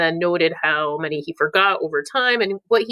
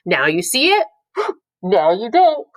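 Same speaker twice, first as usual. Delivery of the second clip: mock surprise; 4 dB louder than usual. Object permanence peekaboo demo.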